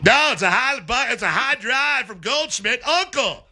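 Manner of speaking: high, rising play-by-play call; home run call. Retold or imitated. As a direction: call the fly ball like a broadcaster. Mimicking the radio home run call.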